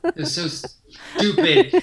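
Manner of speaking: a high, loud groan of self-disgust; mock disgust. Telling a friend how dumb the moment felt, mock disgusted groan. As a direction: whine it like you can't believe yourself. Retelling own embarrassing reaction.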